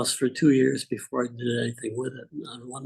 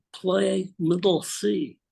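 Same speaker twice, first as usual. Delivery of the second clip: put-on very slow, higher Russian accent order; 7 semitones higher, much slower than usual. Russian teacher telling pupil to play, slow Russian accent. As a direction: draw the command out in a heavy Russian accent. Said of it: "in his heavy Russian accent".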